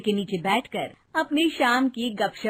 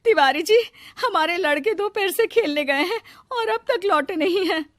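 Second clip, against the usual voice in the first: high worried woman's voice; fretful high-voiced appeal; anxious mother pleading; 8 semitones higher, 4 dB louder than usual. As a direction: plead for help, pitched well up. Frightened mothers plead with the sarpanch.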